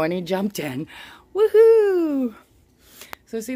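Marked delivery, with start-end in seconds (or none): 1.3-2.3 high, loud woohoo; celebrating. Real, not acted. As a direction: cheer out loud in triumph. Celebrates iguana jumping in.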